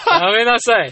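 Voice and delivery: loud, high telling-off after laughing; mock scolding. Host telling co-host to stop joking, mock scolding. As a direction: cut off the joker, amused but loud.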